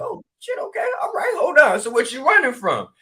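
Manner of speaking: high, startled mock alarm; retold startled gasp of surprise. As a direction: jump in surprise, squeaky and high. Startled reaction, retold session.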